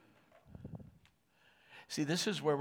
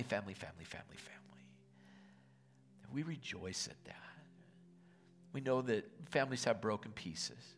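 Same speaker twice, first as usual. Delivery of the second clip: prayer; 4 dB quieter than usual. Real, not acted. Pastor praying over the congregation at Thanksgiving, hushed and earnest. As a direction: pray it softly and sincerely over the people.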